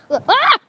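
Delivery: sudden loud high cry; startled yelp. Real, not acted. Hurt on the walk, sudden yelp.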